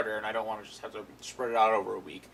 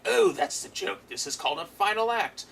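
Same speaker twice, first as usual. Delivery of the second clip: high, playful villain voice, much higher; Joker voice; 8 semitones higher, 3 dB louder than usual. Retold or imitated. Joker tells the kid the trap is the joke, in a high gleeful voice.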